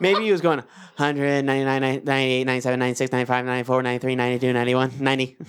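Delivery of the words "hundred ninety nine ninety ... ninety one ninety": low, monotone auctioneer rattle; rapid-fire counting. Acted. Joking about a patient counting backwards under anesthesia, rapid-fire. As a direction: race through it on one low note. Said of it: "Just going real fast".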